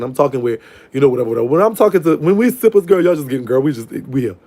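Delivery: normal talking voice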